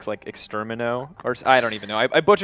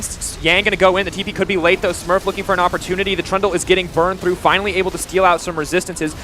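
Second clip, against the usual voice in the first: loud, high esports casting; play-by-play; 6 semitones higher, 5 dB louder than usual. Real, not acted. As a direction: call the fight as it happens. Live play-by-play of a fight.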